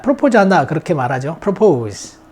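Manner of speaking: ordinary delivery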